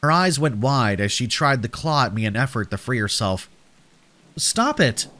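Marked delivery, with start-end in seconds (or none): 4.4-5.1 much higher-pitched exclamation at normal volume; sharp protest. Acted. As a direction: cry out high to stop a struggling child, without shouting. Narrator struggles with biting girl.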